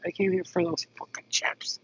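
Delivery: a real annoyed whisper, voiceless; fuming quietly so no one in the store hears; angry whisper. Real, not acted. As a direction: whisper the complaint through real irritation. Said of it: "I'm so mad right now".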